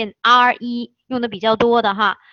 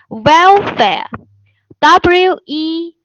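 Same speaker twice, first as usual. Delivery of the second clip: welfare twice, then its letters very slowly and louder; loud dictation drill; 6 semitones higher, 7 dB louder, much slower than usual. Shown as a demonstration. Teacher dictating welfare loudly and very slowly. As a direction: call it out slowly for students to write down.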